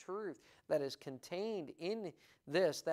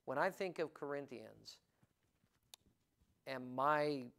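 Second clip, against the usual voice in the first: ordinary talk, nothing marked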